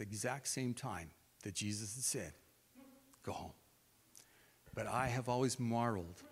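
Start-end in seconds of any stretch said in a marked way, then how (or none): 3.2-3.5 hushed. Acted. Jesus's words, whispered.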